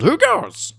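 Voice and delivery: high, loud squeaky lizard voice; kobold challenge. A narrator's reading. Kobold guard calling down from the wall, high and loud. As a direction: yelp it like a tiny sentry.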